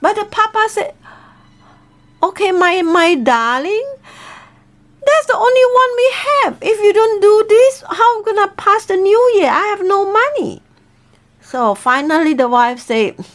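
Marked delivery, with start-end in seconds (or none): 2.2-10.7 husband pleading. Acted. Husband insists to wife.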